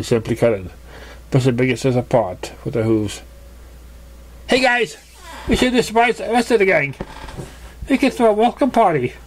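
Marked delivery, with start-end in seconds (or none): 4.5-9.1 higher, giggly party pitch; eager Pinkie. Acted. Pinkie butting in with a party plan, eager. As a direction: bubble over with the idea.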